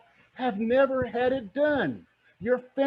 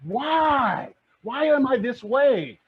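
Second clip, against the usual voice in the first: Stacy hyped; 5 semitones higher, 5 dB louder than usual. Retold or imitated. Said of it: "Stacy's like".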